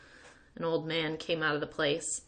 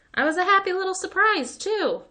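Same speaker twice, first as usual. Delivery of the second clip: much higher and louder, beaming; chirpy pride; 14 semitones higher, 7 dB louder than usual. A narrator's reading. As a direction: boast it sweetly. Kid proudly chirps.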